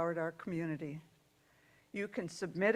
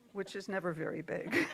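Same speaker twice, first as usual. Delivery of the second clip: breaking into laughter; tickled aside that tips into a real laugh; amused aside. Real, not acted. Reader joking to congregation about baby hummingbirds, amused and laughing.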